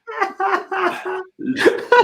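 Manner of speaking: squeaky high laugh; high giggle. Real, not acted. Laughter after joke.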